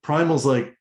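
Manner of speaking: ordinary delivery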